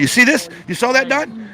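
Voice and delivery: high, urgent look-at-this; excited pointing out. Real, not acted. Reporter to anchor, urgently pointing at the agent on screen, excited. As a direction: pitch jumps up as he spots him.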